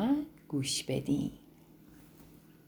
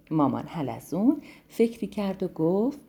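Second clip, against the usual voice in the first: ordinary delivery, nothing marked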